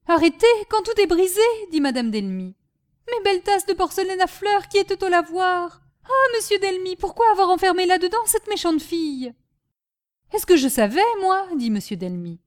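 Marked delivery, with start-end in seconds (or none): 0.1-1.4 high, loud incredulous retort; reproachful. Acted. Wife answering husband's late rescue plan, reproachful. 3.1-9.3 high-pitched plaintive wail; lamenting. Acted. Wife mourning porcelain cups to husband, lamenting. 10.3-11.5 louder, mildly exasperated retort; defensive. Acted. Husband answering wife's reproach, defensive.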